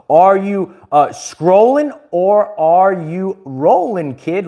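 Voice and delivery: slow, drawn-out catchphrase voice; catchphrase delivery. Retold or imitated. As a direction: stretch it out like a signature line. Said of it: "one of my famous quotes".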